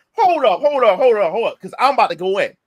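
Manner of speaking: loud, rapid-fire cutting in; forceful interruption. Retold or imitated. Retold interruption in an argument.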